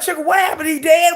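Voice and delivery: high, alarmed questions; mock panic at bad news; panicked disbelief.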